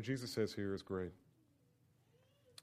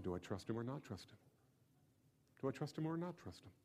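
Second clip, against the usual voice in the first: anxious inner doubt; 3 semitones lower, 7 dB quieter than usual. Acted. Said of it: "Now the man has to make a decision".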